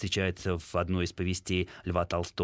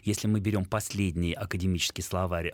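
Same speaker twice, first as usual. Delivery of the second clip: ordinary delivery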